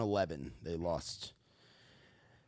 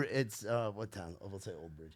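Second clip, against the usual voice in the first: normal talking voice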